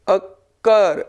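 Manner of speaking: "अकर" split as अ, pause, कर; syllable by syllable. Shown as a demonstration. Teacher showing how to say it, "अकर" split with stress on कर. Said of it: "इसको कैसे अदा करते हैं".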